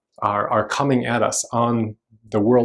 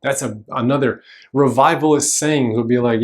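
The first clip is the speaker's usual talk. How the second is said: ordinary talk, nothing marked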